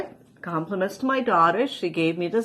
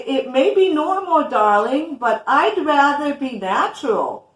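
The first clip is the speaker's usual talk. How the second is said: higher, louder hammed-up Audrey Hepburn accent; mock Audrey Hepburn accent; 5 semitones higher, 5 dB louder than usual. Reading her quote to friend, in mock Audrey Hepburn accent. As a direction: playfully put on Audrey Hepburn's film-star accent. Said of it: "classes on mimicking accents".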